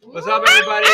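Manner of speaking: loud, high hype greeting; excited shout. Real, not acted. Host greeting the class, excited shout. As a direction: pump up the whole room.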